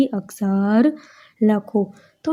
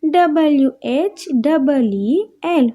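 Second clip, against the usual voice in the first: letters spelt out slowly one by one; slow letter-by-letter spelling; 3 semitones higher, slower than usual. Shown as a demonstration. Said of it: "એમાં પણ ત્રણ spelling છે".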